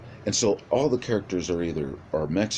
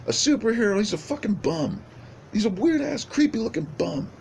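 much higher, mocking disbelief; scornful brother. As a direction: sneer at the idea he's a hero. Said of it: "the brother's like".

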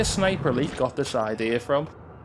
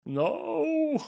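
anguished wail; 14 semitones higher, 3 dB quieter than usual. Real, not acted. Dies again.

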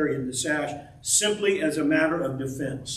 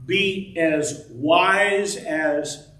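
slow emphatic quote; much slower than usual. Acted. Preacher stresses Jesus's saying.

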